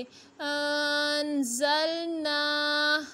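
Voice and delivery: अन ज़ल ना with the ना held one alif long; slow recitation, अन ज़ल ना with a long ना; drawn-out vowel. Shown as a demonstration. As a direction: stretch the final ना after a nasal इख्फा. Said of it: "एक अलिफ की मिकदार तक खींच कर पढ़ेंगे".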